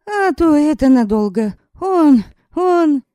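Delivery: high, tight strained order. Acted. Yaska urging the women away, high and strained. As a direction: force it out through a tight throat. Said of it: "сдавленным голосом".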